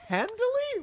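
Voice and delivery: high-pitched, baffled "Handily"; puzzled echo. Real, not acted. Host puzzled by the word friend just used, baffled echo. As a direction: repeat "Handily" way up high in confusion.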